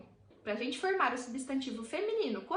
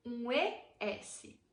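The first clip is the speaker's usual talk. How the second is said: spelled out slowly; much slower than usual. Shown as a demonstration. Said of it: "você deve acrescentar ao final da palavra".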